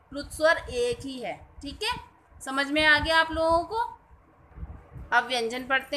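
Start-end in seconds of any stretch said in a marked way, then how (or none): none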